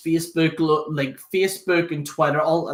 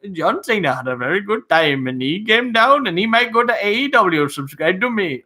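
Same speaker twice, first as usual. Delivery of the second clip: mock Indian accent; 4 semitones higher, 3 dB louder than usual. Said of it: "they're like".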